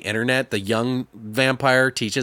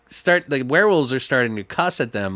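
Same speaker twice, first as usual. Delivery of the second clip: usual speaking voice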